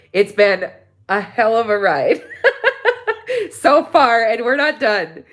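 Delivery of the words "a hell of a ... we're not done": a teary laugh breaking out mid-phrase; real laugh while thanking viewers; laughing through emotion. Real, not acted. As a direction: laugh it off while still choked up.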